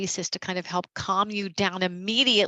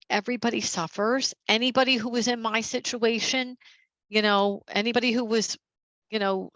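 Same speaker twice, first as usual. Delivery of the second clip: ordinary talk, nothing marked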